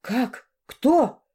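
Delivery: startled questions, jumping up on the second; stunned, rising disbelief; genuinely astonished. A narrator's reading. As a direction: blurt it out in amazement. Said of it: "Вот тут я изумилась по-настоящему".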